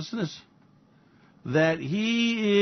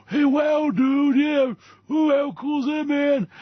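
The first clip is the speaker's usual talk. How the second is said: high, dazed stoner voice; stoned dude; 8 semitones higher, slower than usual. Acted. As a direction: marvel like a stoned surfer. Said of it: "if you get stoned with Elon Musk".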